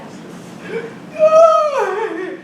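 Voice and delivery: breaks into giggles; loud burst of laughter. Real, not acted.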